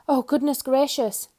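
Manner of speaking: high, loud alarmed cry; frightened cry. A narrator's reading. Mister Jelly crying out in panic at crackling cornflakes. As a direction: squeal it as you dive under the table. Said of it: "cried Mister Jelly".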